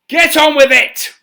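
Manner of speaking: loud, high furious roar. Acted. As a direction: roar the order at him. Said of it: "roared the Emperor".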